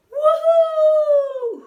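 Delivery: high loud hoot. Acted. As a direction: hoot it like a cheering crowd. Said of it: "hooted the crowd".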